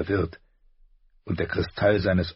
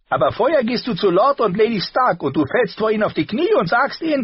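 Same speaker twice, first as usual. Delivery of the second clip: much higher, louder rising command; commanding uncle; 6 dB louder than usual. A narrator's reading. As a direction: build the order harder. Tyrion orders the prince.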